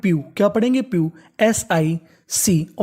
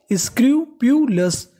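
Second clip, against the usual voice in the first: Scrupulous said slowly and higher, each syllable stretched; slow raised model word; 4 semitones higher, much slower than usual. Shown as a demonstration. Teacher modelling the new word slowly for students. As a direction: lift scrupulous up and draw it out. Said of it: "क्या बन गई?".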